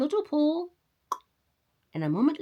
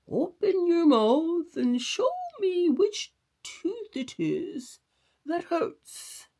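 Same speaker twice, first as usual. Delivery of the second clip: higher, slow dentist voice; 10 semitones higher, 3 dB louder, slower than usual. A narrator's reading. Doctor plays dentist to the rabbit, higher slow voice. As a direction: play the professional dentist. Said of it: "just like a dentist".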